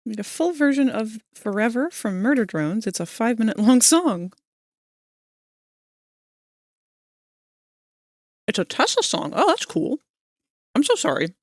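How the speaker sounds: words through a mouthful of food; chewing while reading aloud; talking with mouth full. Real, not acted. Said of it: "I'm not trying to talk with my mouth full".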